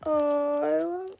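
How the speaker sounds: eyes watering, slow and strained; teary, pained sigh. Real, not acted. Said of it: "My eyes are watering".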